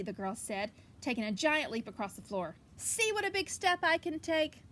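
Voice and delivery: lively picture book reading; story reading. Acted. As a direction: read it out to the kids with energy. Reads picture book aloud.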